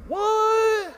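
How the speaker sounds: high, far squeaked what with a laugh; mock disbelief. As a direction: squeak it out in comic shock. Mock disbelief at wife's cart.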